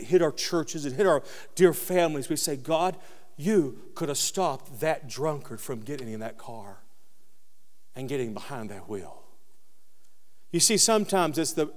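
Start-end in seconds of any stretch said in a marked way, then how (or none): 2.5-9.2 softer, lower, aching complaint; a low, quiet lament to God; hushed lament. Acted. Voicing a grieving complaint to God.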